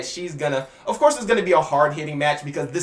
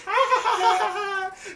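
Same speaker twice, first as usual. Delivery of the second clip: high playful giggle; imitated playful giggle; playful laugh. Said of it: "playfully just laughing about it".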